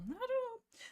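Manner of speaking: "I don't know" lifted high and lilting; playful high "I don't know"; high sing-song "I don't know". Real, not acted.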